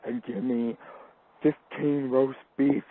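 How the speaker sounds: slow, lightly voiced deep-voiced customer; a hint of an imitated deep voice, drawn out; mock deep voice. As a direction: order slowly, faintly imitating a deep voice. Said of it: "Her voice was real deep".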